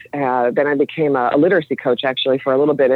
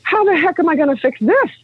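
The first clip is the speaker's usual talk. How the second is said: high-pitched, exasperated inner voice; acted-out dismay; 10 semitones higher than usual. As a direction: mimic being overwhelmed by a sudden realization. Acts out overwhelmed realization about system.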